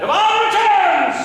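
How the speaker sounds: high shouted proclamation; loud announcement. Acted. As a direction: belt out the line. Shouted line from the play.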